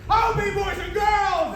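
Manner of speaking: shouted panto question to the crowd; rally the audience; loud, cheery call to the kids. Acted. Asking the crowd.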